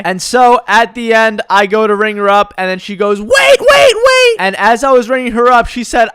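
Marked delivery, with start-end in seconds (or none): 3.2-4.4 much higher, loud shouted wait wait wait; mock shout. Customer halting the cashier at checkout, mock shout. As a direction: stop him cold with a shrill cry.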